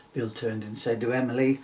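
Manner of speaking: ordinary delivery, nothing marked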